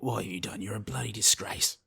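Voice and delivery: hushed, half-whispered mock scolding; fatherly reproach. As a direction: scold gently under your breath. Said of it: "very much a fatherly relationship".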